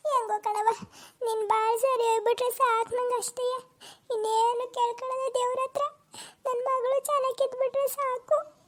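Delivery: high, tearful parent's voice; crying. Acted. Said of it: "ಅಳ್ಬೇಡ ಸುಮ್ಕಿರವ". (ಅಳುತ್ತಾ, ಎತ್ತರದ ಧ್ವನಿಯಲ್ಲಿ)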